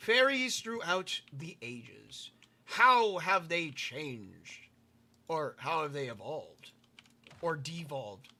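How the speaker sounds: slow, grand documentary voice; documentary narrator. Pretend. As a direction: announce it like a nature film title. Documentary-style title voice.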